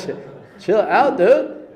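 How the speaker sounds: high, loud, laughing through the words; laughing reassurance. Real, not acted. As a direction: laugh it off for him.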